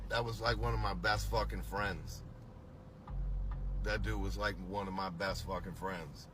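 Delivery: low, softly spoken tribute; subdued. Real, not acted. Recalling a missed best friend, subdued. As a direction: say it low and soft, dropping away.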